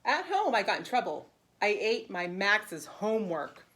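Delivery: bright, lively read-aloud for preschoolers; animated picture-book narration; storybook reading. Acted. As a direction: read it like story time for little kids. Teacher reads picture book aloud.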